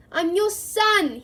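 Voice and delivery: high, loud, slow anguished cry; desperate scream. Acted. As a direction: scream it up at your father. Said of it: "he screamed up at Crouch".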